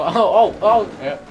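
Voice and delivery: ordinary talk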